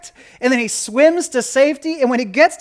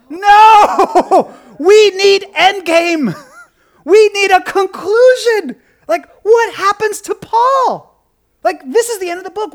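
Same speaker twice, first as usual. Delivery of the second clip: higher, louder, slow pleading; exasperated plea; 9 semitones higher, 7 dB louder, slower than usual. Pretend. Preacher demanding to know Paul's fate, exasperated and loud. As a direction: beg for the ending, drawn out.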